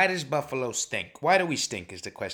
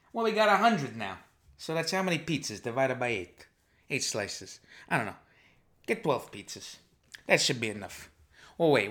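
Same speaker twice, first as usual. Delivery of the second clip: put-on easygoing New York Italian wise guy accent, held throughout; a mobster-style podcast host chatting in his New York Italian accent. A wise guy host greets his listeners in a New York Italian accent.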